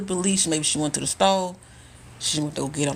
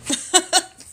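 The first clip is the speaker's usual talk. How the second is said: high laugh; 12 semitones higher, 3 dB louder than usual. Real, not acted. Laughs at lady's plight.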